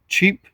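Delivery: "cheap" set apart for emphasis; stress on cheap. Real, not acted. Owner describing new seat covers, stressing "cheap". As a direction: put deliberate weight on "cheap". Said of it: "again, emphasize that word".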